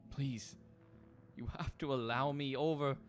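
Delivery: high, desperate begging; pleading spirit. Acted. Distraught soul begging the ferryman, high desperate plea. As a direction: beg to be let across. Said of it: "the person that he's currently pleading with is like".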